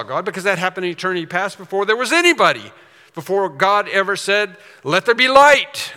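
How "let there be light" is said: proclaimed command. Retold or imitated. Quoting God's creation command.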